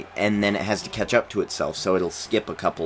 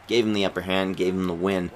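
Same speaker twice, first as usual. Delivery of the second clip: usual speaking voice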